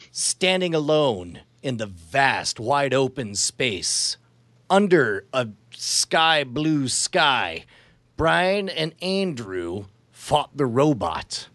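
slow, deliberate storyteller read; slow careful dictation. Shown as a demonstration. Testing the app with a slow narrated line. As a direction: dictate each phrase slowly for the app.

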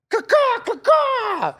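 high shrill caw-caw bird screech; shrill screeching bird call. Acted. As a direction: screech like a crow at the gate. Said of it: "do an animal sound".

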